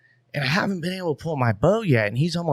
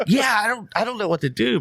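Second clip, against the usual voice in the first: overwhelmed; 8 semitones higher than usual. Retold or imitated. Retells own overwhelmed reply.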